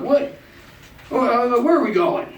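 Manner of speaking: high alarmed question; suddenly alarmed, looking around; weirded out. Retold or imitated. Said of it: "started to get weirded out".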